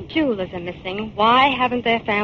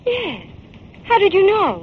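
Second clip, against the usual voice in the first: higher and louder, amazed he guessed; astonished; 9 semitones higher, 4 dB louder than usual. Acted. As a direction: gasp it out, amazed. Fern astonished that Jim guessed the name.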